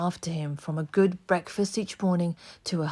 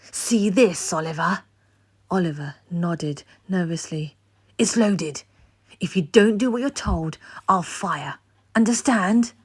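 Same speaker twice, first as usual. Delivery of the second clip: menacing threat; 6 dB louder than usual. A narrator's reading. Bill threatens with pistol.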